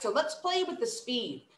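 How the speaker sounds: ordinary delivery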